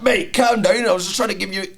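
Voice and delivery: playing the offended fella; mock director. Director telling the lad to calm down, mildly mocking. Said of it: "And he was like".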